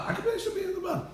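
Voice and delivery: high, fast, laughing disbelief; mock disbelief. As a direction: toss it off as an absurd question through a laugh. Rhetorical disbelief in a class.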